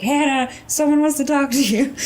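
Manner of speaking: high, loud, singsong tease; teasing coworker. Retold or imitated. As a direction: call out to her mockingly. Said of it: "And they're like".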